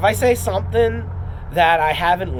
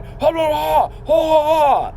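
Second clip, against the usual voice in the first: loud high "ha ha ha" shouts; mock fight yell; 12 semitones higher, 7 dB louder, slower than usual. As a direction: bark out high ha's like a brawler. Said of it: "you're yelling and screaming and going".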